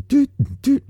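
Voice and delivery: high-pitched rhythmic "doot" sounds; mimicked music beat. Speaker mocks the Spanish station's music, high toots on the beat. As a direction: toot out a simple beat up high. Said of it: "has that same beat tempo".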